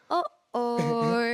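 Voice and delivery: high put-on "Uh-oh" with a laugh in it; mock the trainer's comic uh-oh; funny Mister Wong. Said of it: "he literally said that".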